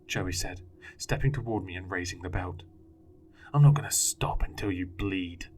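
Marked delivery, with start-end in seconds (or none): 3.5-5.5 menacing threat. A narrator's reading. Father threatens with belt.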